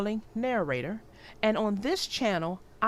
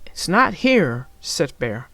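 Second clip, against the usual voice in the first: put-on clear, lively read-aloud for young children; storybook narration; 8 dB louder than usual. A narrator's reading. Narrator reading Franklin's Blanket to young viewers, storybook read-aloud. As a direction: tell the story warmly to little listeners.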